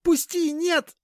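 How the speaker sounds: high, loud panicked shriek. Acted. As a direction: scream it in terror. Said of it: "завизжала Аня".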